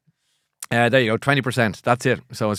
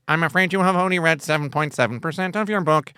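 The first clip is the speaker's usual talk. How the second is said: higher-pitched mock robot reading out a status; eighties robot; 4 semitones higher than usual. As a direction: play an apologetic eighties e-reader robot. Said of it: "is like an eighties robot".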